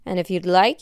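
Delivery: usual speaking voice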